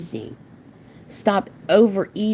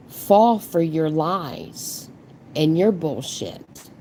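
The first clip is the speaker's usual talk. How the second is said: ordinary delivery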